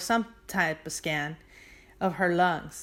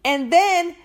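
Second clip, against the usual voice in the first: loud, high excited reveal; 5 semitones higher, 7 dB louder than usual. Real, not acted. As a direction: build up the twist loudly. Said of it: "excited reveal".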